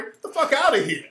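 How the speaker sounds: ordinary talk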